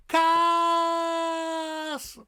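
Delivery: kaas held very long and high; hugely stretched, high kaas; exaggerated Dutch vowel. Shown as a demonstration. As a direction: sing out the aa of kaas. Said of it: "energy you have to put into".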